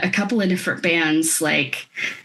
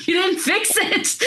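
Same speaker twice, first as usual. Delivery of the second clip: high, slow, laughing through the quote; laughing retort; 10 semitones higher than usual. Retold or imitated. Retelling her reply to Jacob, laughing hard. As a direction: retell the comeback while cracking up. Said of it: "And I'm like".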